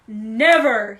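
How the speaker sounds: very loud, booming warning; furious, forceful command; thundered. A narrator's reading. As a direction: thunder the word out. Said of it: "he thundered".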